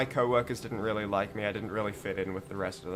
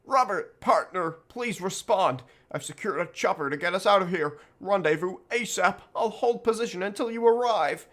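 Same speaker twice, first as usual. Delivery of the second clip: raised, pleading partner on the radio; urgent radio voice; 8 semitones higher, 4 dB louder than usual. Acted. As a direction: call out over a crackling radio. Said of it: "The voice of an old friend crackled through".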